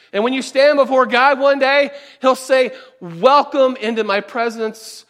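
loud, driving sermon voice; fervent preacher rising in force; preaching at full force. Acted. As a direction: press the point home to the congregation.

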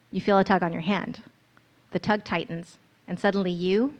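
ordinary delivery, nothing marked

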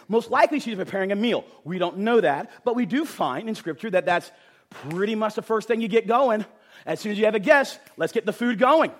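normal talking voice

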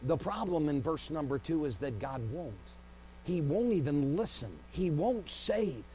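normal talking voice

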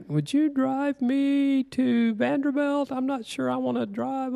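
high, slow, pleading wife voice; wife asking a favor. Retold or imitated. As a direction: ask sweetly like a hesitant wife. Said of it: "She kinda looked at me like".

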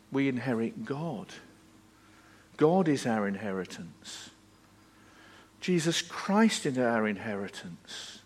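soft, low, weighty preaching; solemn and hushed. Real, not acted. Preacher telling the congregation of their inheritance in God, hushed. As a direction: drop low and quiet, with reverent pauses.

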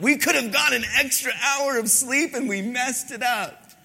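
high, loud outburst imitating the wife; mock angry wife. Husband imitating his wife angry about lost sleep, loud. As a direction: throw up your hands in exasperation. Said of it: "she was so angry".

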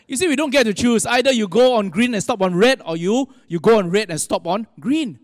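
usual speaking voice

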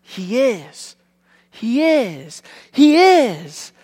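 building from normal to loud and high over the three; each "He is" bigger until a ringing cry; triumphant answer. Retold or imitated. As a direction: swell into a triumphant shout. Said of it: "big triumphant sound".

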